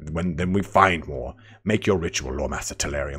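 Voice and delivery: arrogant, official elf with a British twang; measured, to-the-point British elf delivery; haughty high elf. Acted. Streamer demonstrating proper high elves, haughty and official. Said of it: "I see them as more this".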